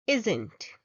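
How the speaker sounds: isn't slow, weight on the first syllable; isn't, first syllable strong; stress IS-n't. Shown as a demonstration. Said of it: "The stress is on the first syllable".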